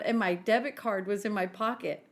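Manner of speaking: usual speaking voice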